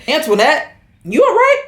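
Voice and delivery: high-pitched imitation of a Southern man; Southern accent. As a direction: call it out Southern, leaping up at the end. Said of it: "American Southern, Southernist accent".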